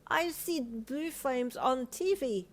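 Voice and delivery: put-on high, slow child voice; little boy voice. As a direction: say it like a small boy. Child speaking.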